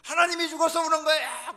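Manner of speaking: loud, high-pitched disbelief; incredulous acted yell. Luther questioning his weeping wife, acted incredulous yell.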